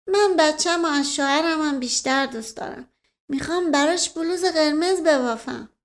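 put-on simple-hearted girl chatting about her baby; innocent Qamar. A narrator's reading.

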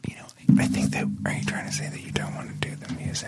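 soft conspiratorial whisper. Acted. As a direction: ask it in a hushed aside. Hosts drop into whispers.

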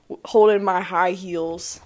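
ordinary talk

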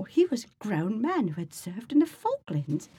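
high-pitched amateur British accent; hammed-up British reading voice. Shown as a demonstration. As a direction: put on a lilting, not very good British accent. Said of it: "I'm gonna read this in a British accent".

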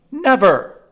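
the queen's furious, high, loud refusal. Acted. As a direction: bark it out in outrage. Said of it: "furiously throwing an inkstand".

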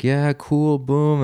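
high, chirpy, overly friendly officer voice; breezy border officer. Retold or imitated. Customs officer chatting up the returning traveler, breezy and high. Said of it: "he's like".

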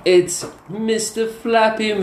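high, slow, sing-song nickname; high teasing voice. Pretend. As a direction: tease him with a high voice.

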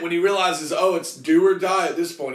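ordinary talk

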